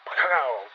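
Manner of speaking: loud "pow" crash noise; imitated impact. Storyteller replaying the tractor clobbering him, loud impact noise. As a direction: punch out the sound of a collision.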